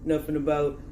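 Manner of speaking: joking bad Stallone accent; movie boxer rambling to his sweetheart; Rocky Balboa. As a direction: put on a horrible Stallone-style boxer voice. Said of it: "my horrible Sylvester Stallone accent".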